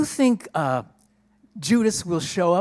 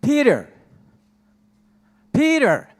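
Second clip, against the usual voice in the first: calling out; 6 semitones higher than usual. Acted. John calls out to Peter, looking for him. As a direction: call the name out, rising high then falling.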